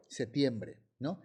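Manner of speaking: ordinary delivery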